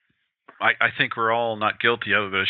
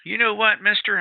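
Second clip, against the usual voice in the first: put-on high-pitched little kid's voice; little girl; 12 semitones higher than usual. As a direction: pipe up like a curious seven-year-old, much higher. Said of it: "this little girl ... she goes".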